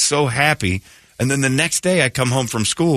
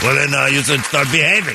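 put-on higher, scolding French-accented elf; 4 semitones higher than usual. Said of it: "French accent".

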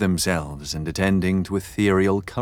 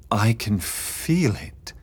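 eerie medium voice; 2 semitones higher, slower than usual. Acted. Medium in trance again.

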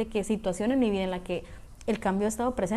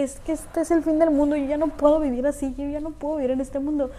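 higher, louder, hammed-up end-of-the-world wail; mock melodrama; 4 semitones higher, 3 dB louder than usual. Retelling her past panic about change, mock melodramatic wail. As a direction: imitate her old dramatic despair, overplayed. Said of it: "así de que".

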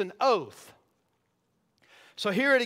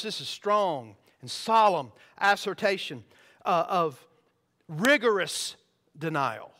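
normal talking voice

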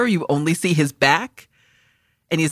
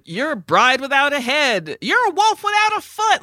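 mock shouting; 9 semitones higher than usual. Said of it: "them shouting".

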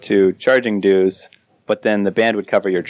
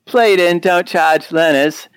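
higher-pitched put-on Australian accent; 5 semitones higher, slower than usual. Shown as a demonstration. Cohost reading a listener's comment on request, Australian accent. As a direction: read it out in a mock Australian accent. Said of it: "All right, Australian accent".

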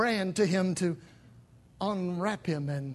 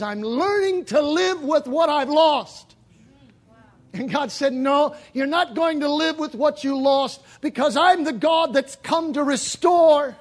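loud, high, slowed preaching; full-force preaching; 7 semitones higher, 8 dB louder than usual. Acted. Preacher proclaiming God restores, full-force preaching. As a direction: declare it with conviction. Preacher voicing God's answer.

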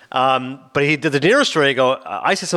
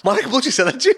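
mock astonishment; 9 semitones higher, faster than usual. Imitating an astonished listener.

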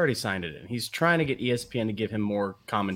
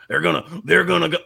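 put-on playful Mississippi accent, louder; 5 semitones higher, 5 dB louder, faster than usual. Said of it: "Am I doing a Mississippi accent correct?".

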